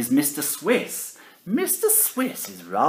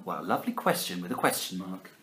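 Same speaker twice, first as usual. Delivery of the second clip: plain reading voice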